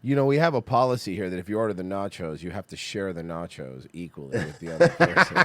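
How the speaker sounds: mock waiter. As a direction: announce the house rule like a stiff waiter. Mock waiter policy voice, much lower.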